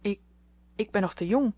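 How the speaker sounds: halting, flustered start; hesitant, broken-off stammer. A narrator's reading. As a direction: stumble on the first word, then get it out. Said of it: "stamelde Meta".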